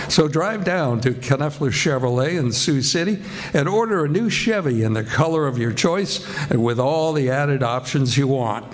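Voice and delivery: ordinary delivery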